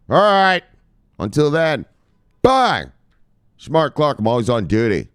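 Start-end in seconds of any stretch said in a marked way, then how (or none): 0.1-2.9 high, loud farewell; saying goodbye to viewers, high and loud; loud sign-off. Real, not acted. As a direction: sign off with a loud, playful bye. Signature sign-off.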